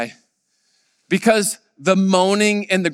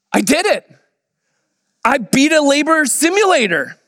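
triumphant; 9 semitones higher, 3 dB louder than usual. Retold or imitated. Husband triumphant after simulator.

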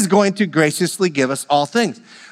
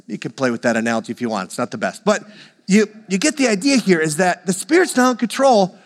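normal talking voice

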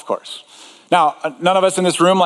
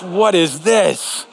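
dismayed; 3 semitones higher, much slower than usual. Retold or imitated. Shoveling snow, dismayed.

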